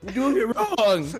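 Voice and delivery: mock parental correction; scolding parent. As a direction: scold a kid in mock exasperation. Weather god scolds his kid.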